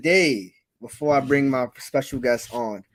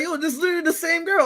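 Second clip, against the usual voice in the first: excited; 13 semitones higher, faster than usual. Retold or imitated. Excited realization quoted.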